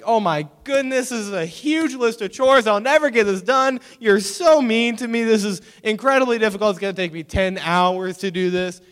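high, loud whiny protest; hammed-up middle-schooler meltdown; overreacting kid. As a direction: wail in mock outrage at an unfair burden. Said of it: "You're overreacting".

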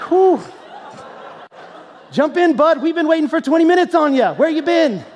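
hammed-up relief, playing it cool; mock relieved casual call. Pastor acting casual to the boy, loud mock relief.

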